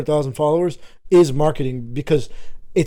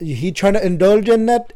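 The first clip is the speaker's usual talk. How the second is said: put-on Indian accent; 4 semitones higher, 5 dB louder than usual. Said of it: "Indian accent?".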